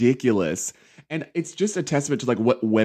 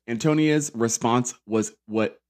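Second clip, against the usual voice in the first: ordinary talk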